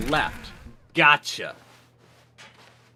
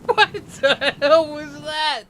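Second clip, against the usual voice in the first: incredulous; 12 semitones higher, much slower than usual. Real, not acted. Reacting to outdated video.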